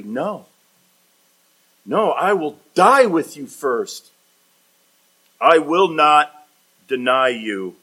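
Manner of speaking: loud, insistent vow in separated phrases; emphatic refusal. Acted. Peter refusing Jesus' prediction, emphatic. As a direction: refuse firmly, leaning hard on the key words. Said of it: "And Peter says emphatically".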